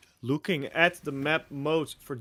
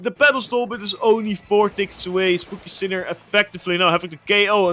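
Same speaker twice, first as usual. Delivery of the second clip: higher esports casting, easing down; excited play-by-play; 6 semitones higher, 6 dB louder than usual. Real, not acted. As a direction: bring the big call down gradually.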